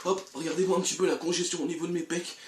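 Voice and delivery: usual speaking voice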